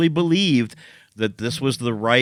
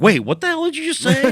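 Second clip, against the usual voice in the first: much higher, stunned disbelief; shocked; 5 dB louder, faster than usual. Retold or imitated. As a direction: blurt it out in total shock. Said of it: "someone was like".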